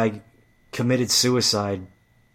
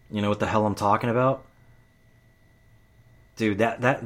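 usual speaking voice

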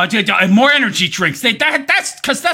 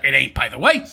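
ordinary delivery

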